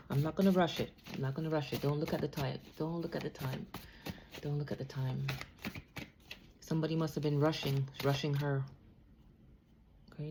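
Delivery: normal talking voice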